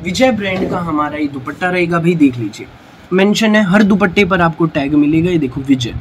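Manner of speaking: overeager teleshopping pitch. Acted. Selling dupattas loudly on camera.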